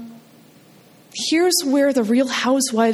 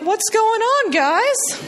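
put-on high, breezy kid voice; high and carefree, playing her clueless nine-year-old self; oblivious kid; 10 semitones higher, slower than usual. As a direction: chirp it like nothing happened.

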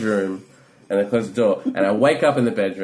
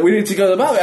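raised, high voice of an impatient crowd; acted urgent demand; 11 semitones higher, 7 dB louder, much faster than usual. Partygoers outside the locked bathroom door, acted urgent demand. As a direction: call it out as if pounding on a door.

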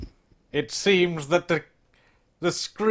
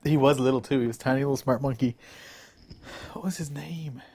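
whisper; 6 semitones lower, 8 dB quieter, much faster than usual. Real, not acted. Whispered aside.

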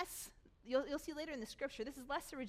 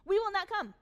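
defiant refusal; 7 semitones higher, 8 dB louder than usual. Retold or imitated. Rebels refuse Moses' summons.

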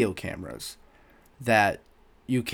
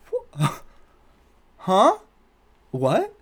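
high, baffled "huh?"; stunned; 9 semitones higher, 6 dB louder, much slower than usual. Retold or imitated. Employee reacting to coworker's insult in front of a customer, stunned. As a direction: stammer out disbelief, jumping up in pitch.

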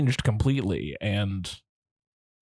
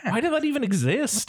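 squeaky, high disbelief; incredulous high; 13 semitones higher than usual. Real, not acted. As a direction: pitch way up in disbelief. Incredulous, much higher.

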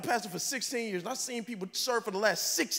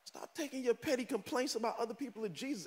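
soft closing rebuke; 9 dB quieter than usual. Shown as a demonstration. Pastor quietly closing the rebuke, soft. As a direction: lay it down gently. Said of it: "Stop taking nonsense to Jesus".

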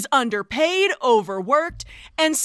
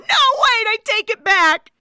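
desperate plea; 8 semitones higher, 5 dB louder than usual. Retold or imitated. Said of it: "she starts crying".

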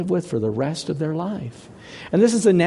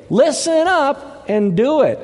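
much higher, emphatic order; urgent command; 9 semitones higher than usual. Retold or imitated. Preacher paraphrasing God's command to the people, urgent order. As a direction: punch it out as a commandment.

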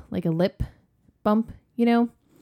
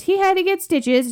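defensive dad; 11 semitones higher, 5 dB louder than usual. Retold or imitated. Dad voiced answering mom.

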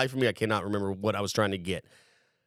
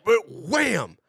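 mock impact sound; 9 semitones higher than usual. Imitating hitting the window.

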